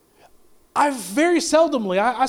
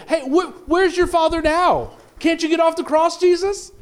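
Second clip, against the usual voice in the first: higher, laughing jeer at a man on a cross; jeering mockery; 6 semitones higher than usual. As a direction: taunt him with a laugh, rising on his name. Crowd jeering Jesus on cross.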